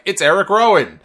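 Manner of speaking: big loud announcer shout; excited announcer. Retold or imitated. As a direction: call it out with mock excitement. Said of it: "J B L's like".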